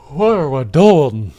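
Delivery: loud, slow, baffled outcry; exasperated. Real, not acted. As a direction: throw it out in disbelief. Baffled outburst at chat.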